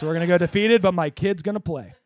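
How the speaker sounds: normal talking voice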